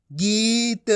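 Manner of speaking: गीत with a long held ee; stretched ee in गीत; long ī, half final. Shown as a demonstration. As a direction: draw out गीत, ending on a light t. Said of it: "आपको आधा करना है".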